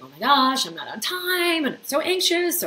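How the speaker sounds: high, loud flustered panic; anxious panic. Retold or imitated. Driver running late, voicing rising panic: high flustered. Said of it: "you're noticing, like".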